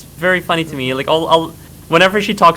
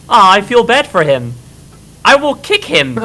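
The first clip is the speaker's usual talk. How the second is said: mock Alice; 7 semitones higher, 4 dB louder than usual. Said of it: "So it's like".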